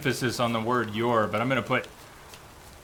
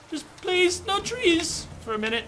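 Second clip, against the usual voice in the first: pleading in a much higher whine; whiny pleading. Real, not acted. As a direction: beg the game to spare him trees. Begs the game for no trees.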